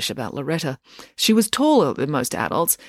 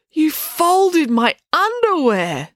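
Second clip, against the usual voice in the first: high, slow, appalled exclamation; scandalised outrage; 12 semitones higher, 4 dB louder, much slower than usual. A narrator's reading. April opening her invaded drawer, scandalised outrage. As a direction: draw out every word in horror.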